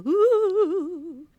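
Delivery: high, loud drawn-out woo; long high "woo", trailing away; playful woo. Real, not acted. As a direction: whoop it out on a wavering high note. Playful cheer after naming the festival.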